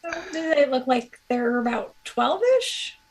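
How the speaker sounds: ordinary talk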